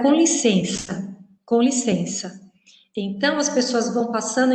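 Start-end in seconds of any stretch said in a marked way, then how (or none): 0.0-2.4 "com licença" slow, every syllable pronounced; full careful form. Shown as a demonstration. Teacher revealing the mystery phrase, "com licença" in full. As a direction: spell out "com licença" clearly and slowly. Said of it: "Era o".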